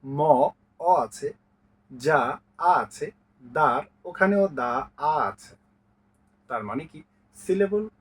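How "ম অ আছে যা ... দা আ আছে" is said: very slow, each syllable and vowel isolated; walk the class through each sound slowly; slow explanation. Shown as a demonstration. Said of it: "শব্দাংশেও প্রত্যেকটিতে কিন্তু স্বরধ্বনি". (খুব ধীরে প্রতিটি স্বর দেখিয়ে)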